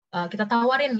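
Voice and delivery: ordinary talk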